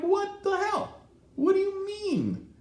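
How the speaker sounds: high-pitched mock-outraged squawk; indignant retort. Retelling his protest to the tow company, indignant. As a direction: retell it incredulous, voice jumping way up.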